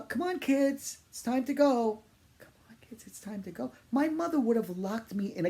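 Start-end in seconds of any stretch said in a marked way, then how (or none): 0.0-2.0 put-on high, loud call to the kids; a mother calling her kids to leave; yelled out. Mother yelling to her kids to leave, loud high call. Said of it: "She just yelled out". 2.4-3.7 whisper. Retold or imitated. Same call repeated as echo.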